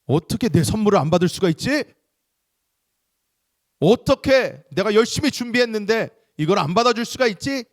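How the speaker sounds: acted outrage, high and loud; indignant anger. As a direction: protest loudly as if your gift was snubbed. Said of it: "분노가 끓어오르실 것입니까?".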